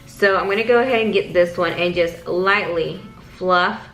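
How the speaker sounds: ordinary talk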